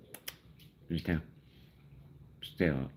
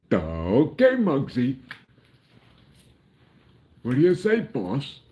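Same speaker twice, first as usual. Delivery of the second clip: high, loud cartoon henchman voice; squeaky cartoon lackey sucking up to the boss; cartoon sidekick; 12 semitones higher, 9 dB louder than usual. Cartoon sidekick answering his gangster boss, high and loud. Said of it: "Mel Blanc from cartoons".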